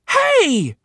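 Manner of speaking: put-on high, loud kid's protest; indignant shout. Johnny protesting Joey's blame, indignant shout. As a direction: shout back at the betrayal. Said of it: "Johnny shouted".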